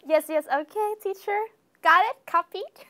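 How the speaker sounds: chirpy obedient student; eager student. Retold or imitated. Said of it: "student replying to teacher".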